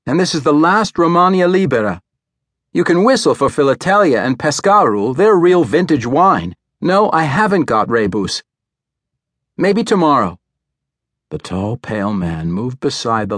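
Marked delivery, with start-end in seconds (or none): 0.1-10.4 high, bright-voiced young newsgirl; chirpy kiosk girl. A narrator's reading. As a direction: call it out high and quick. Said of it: "the soprano".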